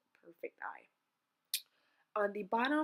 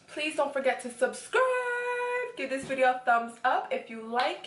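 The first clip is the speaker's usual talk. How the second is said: fortune teller; 6 semitones higher, 12 dB louder than usual. Acted. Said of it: "I am a fortune teller".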